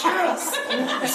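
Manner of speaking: a very high, laughing yes; joyful yes. Retold or imitated. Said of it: "I'm like".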